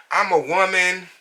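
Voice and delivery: high-pitched mock indignation; put-on high voice of an affronted customer; mimicked client protest. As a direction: imitate her protest in a high, insistent voice. Acting out client's threatening protest.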